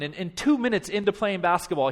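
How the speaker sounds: ordinary delivery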